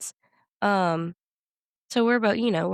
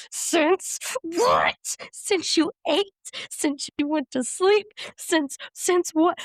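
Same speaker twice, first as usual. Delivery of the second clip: high, slow, incredulous questioning; exasperated; 9 semitones higher, much slower than usual. Retold or imitated. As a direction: drag out each question, exasperated mom. Mom repeating the question, exasperated.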